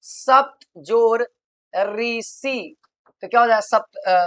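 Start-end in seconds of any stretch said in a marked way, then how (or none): 0.0-2.8 slow demo. Shown as a demonstration. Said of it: "उदाहरण".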